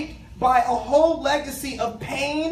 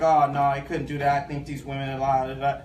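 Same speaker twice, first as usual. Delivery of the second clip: much lower, dismissive mock of deniers; 10 semitones lower than usual. Imitating folks who excuse a celebrity predator, low mock dismissal. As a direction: drop low and brush it off in mock disbelief. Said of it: "folks are like".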